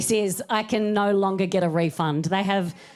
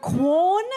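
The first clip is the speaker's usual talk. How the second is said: Quorn? with a high rising question lilt; apologetic question; 6 semitones higher, 4 dB louder than usual. Shown as a demonstration. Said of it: "It's so apologetic".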